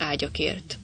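plain reading voice

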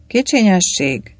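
ordinary delivery

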